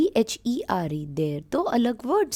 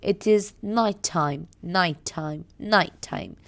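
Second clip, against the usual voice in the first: slowly drawn out night time; teacher modelling night time slowly; slow demo; 3 semitones lower, much slower than usual. Shown as a demonstration. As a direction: stretch each word for the class. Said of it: "See, when I say night".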